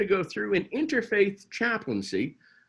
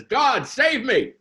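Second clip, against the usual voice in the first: raised, slowed plea upward; cry to God; 5 semitones higher, 5 dB louder, slower than usual. Acted. Woman at the window begging God, loud slow plea. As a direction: call out to heaven for rescue.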